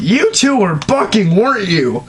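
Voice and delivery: high, slow mock accusation; mock yell. Reading smut aloud to a friend, high mock yell. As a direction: hams up the princess yelling. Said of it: "she abruptly yelled out".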